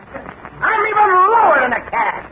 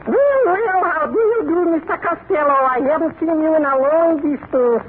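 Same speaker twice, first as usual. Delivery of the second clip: Kitzel salesman voice. Acted.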